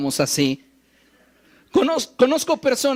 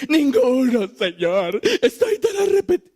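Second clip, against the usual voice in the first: hammed-up wail, pitch leaping higher on arrepentido; mock dramatic repentance; 3 semitones higher than usual. Preacher mocking a showy penitent, hammed-up wailing. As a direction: overact the sorry sinner, rising into a wail. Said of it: "Ella no se puso a decir".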